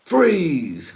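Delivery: sudden high yelled command; mock cop shout. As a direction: bark it like a cop bursting in. Cop bursting in on couple.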